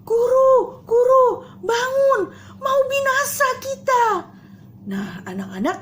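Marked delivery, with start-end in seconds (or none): 0.0-4.0 high, loud, terrified cry for help; panicked crying out. Acted. Disciples in the storm cry out to Jesus, terrified. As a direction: scream for the teacher to wake up. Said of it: "mereka teriak-teriak, mereka panggil".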